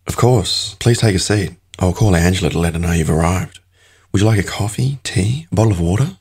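put-on polite receptionist with a refined British-tinged accent; a receptionist greets the new trader in a refined British-tinged accent; refined almost British accent. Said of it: "speaks with a refined, almost British accent".

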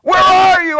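high, loud cry of where are you; anguished cry. Retold or imitated. Believer crying out to God, loud and pleading.

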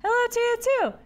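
high cheery small-talk voice; chipper character voice. Acted. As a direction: chirp a cheerful greeting. Playing character greeting Ben.

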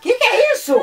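high shriek through laughter; laughing shriek. Real, not acted. Grandma shrieks at video.

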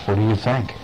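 plain reading voice

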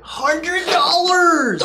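high, drawn-out mock cry; price shock. Customer seeing the haircut bill, price shock. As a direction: stretch it out high in comic horror.

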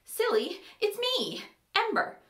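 put-on high, girlish character voice; eager reassurance. A narrator's reading. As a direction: lift it high to coax recognition. Girl pleading to be recognised.

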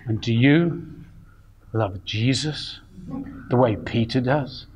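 low, slow, hushed searching question; imitating mentor. Joe copying his mentor's question to a Bible student, low and slow. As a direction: sink the voice low and soft, stretch it out. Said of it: "I'd try and imitate him and go".

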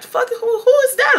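high, incredulous outburst; who-on-earth indignation; incredulous reaction. Retold or imitated. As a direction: ask it in high-pitched disbelief. Acted shocked reaction to being stared at.

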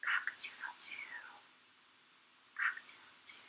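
whispered, voiceless rooster crow; sore-throat rooster. A narrator's reading. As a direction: crow in a feeble whisper, with no voice left. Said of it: "had a sore throat and couldn't speak very loud".

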